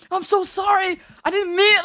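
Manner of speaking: high, loud gushing apology; retold apology. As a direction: imitate him apologizing frantically. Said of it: "he started apologizing to me".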